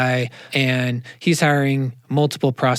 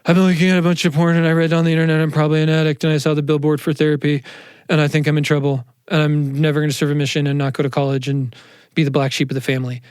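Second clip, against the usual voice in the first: put-on panicked teenager; faster than usual. Teenage kid confessing to his parent in a rushed panic. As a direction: rattle it off like a scared kid. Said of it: "your teenage kid is walking in and they're like".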